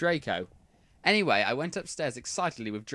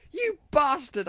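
high mock outburst of rage; angry shout. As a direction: hurl it at him, hammed-up fury. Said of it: "I shouted angrily".

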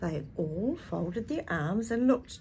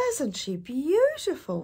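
put-on children's delight; 8 semitones higher, 4 dB louder than usual. A narrator's reading. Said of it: "they said".

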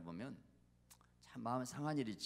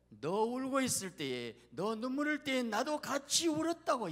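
high, loud, fervent declaration; Jesus' impassioned voice; 11 semitones higher, 7 dB louder than usual. Acted. Jesus tells the weeping believer he wept too, impassioned. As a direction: cry it out as a preacher voicing shared sorrow. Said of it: "예수님은 그렇게 말해요".

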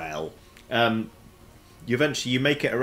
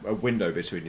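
normal talking voice

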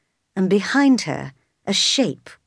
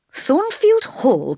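high, drawn-out cry of disbelief; slow, high-pitched incredulous question; astonished cry; 8 semitones higher, 3 dB louder, much slower than usual. A narrator's reading. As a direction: cry out the name in shock, slowly. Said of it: "cried the priest".

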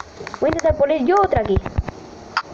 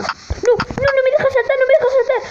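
high-pitched, frantic complaint; panicked gamer complaint; frantic protest; 12 semitones higher than usual. Real, not acted. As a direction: protest in a high, rapid repeated plea. Game won't let player jump.

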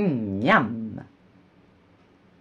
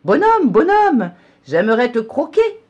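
much higher, very loud call; horse calling out; 10 semitones higher, 10 dB louder than usual. A narrator's reading. Horse in the field calling after the gingerbread man, loud. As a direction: holler hungrily after him. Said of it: "Il lui crie".

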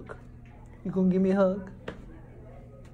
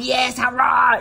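loud roaring cheer; big, loud play roar; playful tiger roar; 7 dB louder than usual. Pretend. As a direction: roar along with a child.

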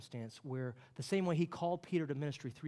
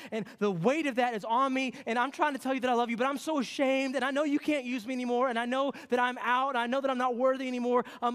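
louder, higher, pleading confessor; ashamed confession prayer; 8 semitones higher, 7 dB louder than usual. Acted. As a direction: pour out shame to God, pleading. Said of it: "felt this way in your approach to God".

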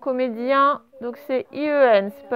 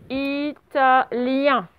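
very slow, evenly split syllables; measured, stretched-out dictation; much slower than usual. Shown as a demonstration. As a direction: repeat it syllable by syllable for young writers. Repeats the dictated word for pupils.

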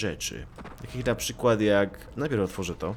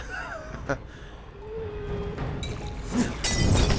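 high cackling laugh. Real, not acted. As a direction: burst out cackling. Big laugh after joke. (wysoki, piskliwy śmiech)

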